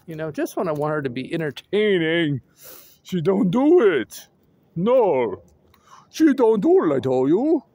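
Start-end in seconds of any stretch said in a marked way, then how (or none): 3.0-7.6 loud, punched mock grumble; in character. Walker rehearsing for a story, put-on character complaint. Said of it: "I'm just getting into character".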